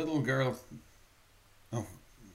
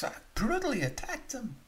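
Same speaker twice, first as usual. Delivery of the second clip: anguished confession; 12 semitones higher than usual. Acted. Saloon man confesses.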